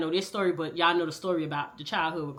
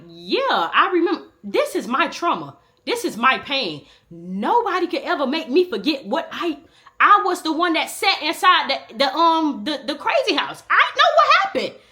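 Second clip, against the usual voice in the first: emotional outburst; 8 semitones higher, 5 dB louder than usual. Retold or imitated. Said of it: "So I'm telling her".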